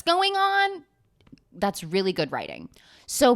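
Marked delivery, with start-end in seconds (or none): 0.0-0.8 mock TV heroine. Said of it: "being like".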